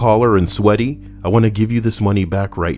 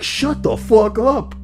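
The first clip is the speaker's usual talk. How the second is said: much higher, slow snap; high mock retort; slower than usual. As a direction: shoot back way up high, drawn out. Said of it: "And I was like".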